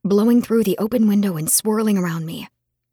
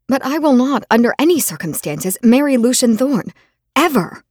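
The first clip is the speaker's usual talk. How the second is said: firm loud refusal; 4 semitones higher, 6 dB louder than usual. Acted. Refusing her father outright.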